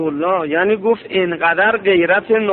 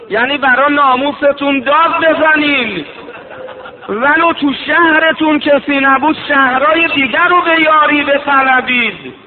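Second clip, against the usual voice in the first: higher and louder, pleading cry; full-force preaching; 6 semitones higher, 4 dB louder than usual. Acted. Preacher urging listeners to call for help, raised plea. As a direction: rise into a public plea.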